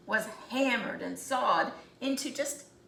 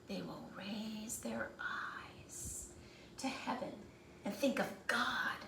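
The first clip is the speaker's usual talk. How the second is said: awed whisper; 9 dB quieter than usual. Acted. Third tree dreaming of heaven, whispered in awe. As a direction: breathe it like a prayer.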